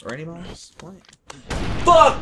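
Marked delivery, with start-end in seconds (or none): 1.8-2.2 high frustrated yell; angry shout. Real, not acted. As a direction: scream the curse in frustration. Frustrated gaming outburst.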